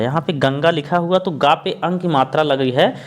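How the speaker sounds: usual speaking voice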